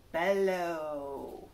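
ordinary delivery, nothing marked

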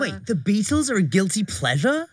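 high, disbelieving question with a rising end; real astonished double-check; incredulous. Real, not acted. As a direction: balk at the very idea, pitch jumping up. Disbelief at Beatles as guilty pleasure.

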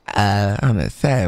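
normal talking voice

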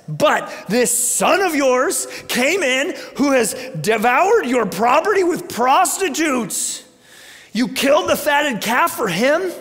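bitter yelling resentment; angry older brother. Acted. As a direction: rail at your father like the wronged son. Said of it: "There, there's some aggression here".

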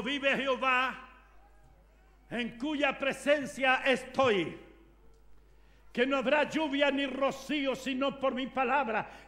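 a hint of solemn prophet, slow; Elijah's oath. Elijah swearing before the king, solemn. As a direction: declare it like Elijah. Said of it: "Elías dijo".

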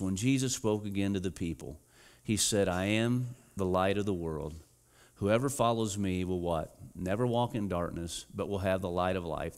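quiet scripture reading. Acted. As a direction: read the verse gently to the church. Reading the verse aloud.

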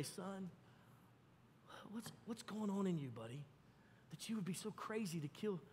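soft, hushed, gentle father's voice; tender fatherly question. Pretend. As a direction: ask it softly, like a dad reaching his hurt son. Father gently asks his son.

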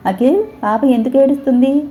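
high, anxious question to husband; flustered worry. A narrator's reading. Mother asks husband why the baby cries, high and anxious. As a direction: panicky and lost, pitched high. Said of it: "కంగారులో".

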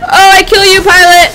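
loud, high gloating shouts; gleeful battle yell. Real, not acted. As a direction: yell it like you just won the fight. Gloating over kills in game.

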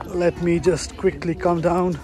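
ordinary delivery

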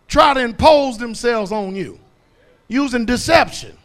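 loud, rising preacher's cry; preaching at full force. Acted. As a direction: hammer each line home to the congregation.

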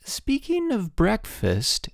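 slow, high-pitched, tentative hope; hopeful. A narrator's reading. Headmaster fishes for breakfast from his wife, hopeful. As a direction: float it up slowly, hinting. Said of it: "said Mister Sturgeon hopefully".

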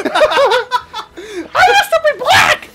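high, slow, loud laughing shout; mock Toad voice. Dubbing Toad about his tan, high and shouting. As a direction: ham it up as a squealing Toad.

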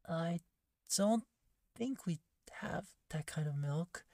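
ordinary talk, nothing marked

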